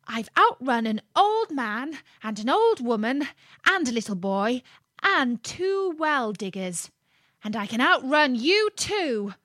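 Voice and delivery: cocky little cookie voice; boastful gingerbread man. Acted. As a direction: crow about being uncatchable.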